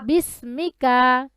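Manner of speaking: drawn-out, measured Arabic syllables; recitation for repeating. Shown as a demonstration. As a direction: model it slowly for a pupil to echo. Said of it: "বলেন".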